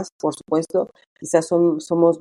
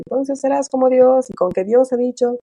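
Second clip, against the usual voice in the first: serpent's tempting words; 8 semitones higher than usual. Retold or imitated. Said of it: "nos hizo creer la serpiente".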